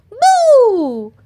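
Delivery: high, loud boo shout; ghost boo. Acted. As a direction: jump out and scare. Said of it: "shouted Peppa".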